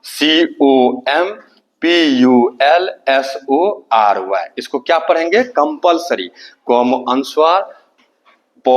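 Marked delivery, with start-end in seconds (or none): 0.0-4.5 letters stretched out slowly; slow letter-by-letter dictation; slow spelling out. Shown as a demonstration. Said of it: "देखिए".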